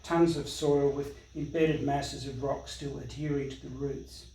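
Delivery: measured, declamatory public reading of news reports; formal literary recital with deliberate pauses; documentary reading. Acted. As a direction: read it out like a solemn chronicle, phrase by phrase. Reads aloud a documentary text on bushfires.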